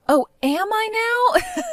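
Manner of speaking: high, slow mocking sing-song. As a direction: taunt her with fake surprise. Mocking mother-in-law's claim.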